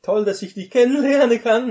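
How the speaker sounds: Adam's bright, loud, fast pickup greeting; mock Adam greeting. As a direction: ham up a cheerful first meeting. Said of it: "nur weil Adam gesagt hat".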